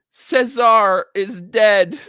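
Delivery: mock-dramatic bad news, slow and loud; imitated tragic announcement. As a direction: draw it out loudly like a hammed-up death announcement. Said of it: "Because of the way she said it?".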